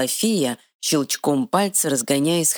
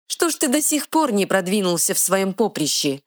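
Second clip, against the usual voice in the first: sarcastic; 6 semitones higher than usual. Acted. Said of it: "съязвила фея".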